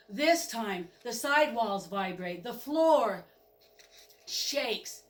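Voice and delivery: usual speaking voice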